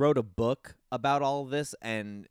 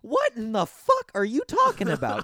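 high, incredulous outburst; mock disgust; 12 semitones higher, 7 dB louder than usual. Podcaster voicing his own disgust at the creepy cop, high and incredulous. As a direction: recoil in disbelief. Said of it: "the dude ... is like".

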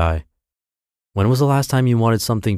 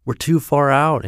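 mild yell; 7 semitones higher than usual. Acted. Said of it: "Anton yells".

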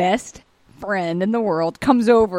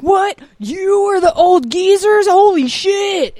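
high, loud, slowed shock; shocked disbelief; 7 semitones higher, 5 dB louder, slower than usual. Retold or imitated. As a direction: hammer each word in disbelief. Friends shocked at reveal.

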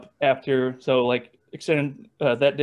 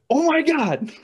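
much higher, loud joyous cry; thrilled disbelief; 14 semitones higher, 5 dB louder, slower than usual. Retold or imitated. Player hearing he's called up to Visalia, joyful cry. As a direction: burst out, overjoyed.

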